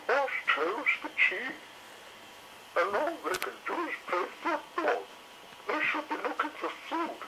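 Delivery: high fretting lady dinosaur; fussy Missus Carey. A narrator's reading. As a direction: complain like a put-upon mother dinosaur.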